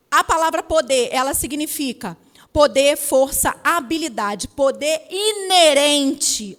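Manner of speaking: loud, declaimed word definition; emphatic preaching. Real, not acted. Preacher defining "poder" for the congregation, emphatic preaching. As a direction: proclaim each meaning loudly, stretching the last.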